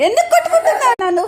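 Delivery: high, loud, laughing complaint; squealing why are you hitting me; laughing protest. Real, not acted. Child squabble, laughing protest.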